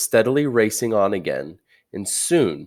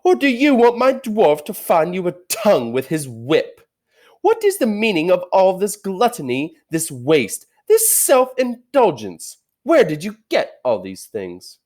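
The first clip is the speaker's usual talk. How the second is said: high, loud, scornful interrogation; a queen raging at a forbidden feast; angry witch queen; 11 semitones higher, 5 dB louder than usual. Acted. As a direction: bear down on them with contempt. Witch interrogating terrified animals.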